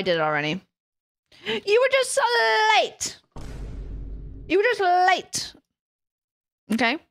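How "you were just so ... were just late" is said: "You were just late" slow with "just" leaned on and drawn out; slow, emphatic "just" in "You were just late"; stress on just. Shown as a demonstration. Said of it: "repeating her own line, slowed".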